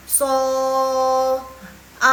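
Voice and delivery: Sol and a held long on one level note; loud, sustained, even pitch on "Sol, a"; first tone. Shown as a demonstration. Teacher pitching tone one on sol, long level notes. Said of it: "nada ke satu itu dia di sol".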